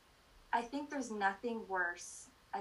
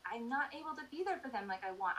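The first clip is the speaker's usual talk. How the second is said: usual speaking voice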